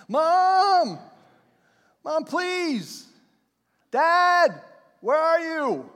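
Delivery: high, loud desperate cries for mom and dad; a lost child shouting for his parents; frantic calling. Retold or imitated. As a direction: beg your parents to find you. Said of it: "crying out".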